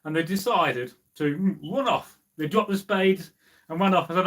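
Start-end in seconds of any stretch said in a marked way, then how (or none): none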